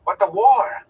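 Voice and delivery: high, loud youthful outburst; eager. Acted. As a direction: jump in eagerly. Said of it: "Mat began eagerly".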